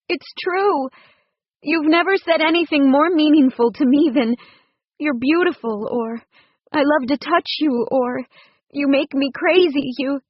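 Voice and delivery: lightly voiced distressed woman confessing her fears; wife's voice. A narrator's reading. Woman's voice, upset.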